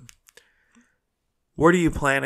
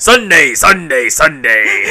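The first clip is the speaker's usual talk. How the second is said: monster truck announcer; 8 dB louder than usual. Shown as a demonstration. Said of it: "You gotta go like".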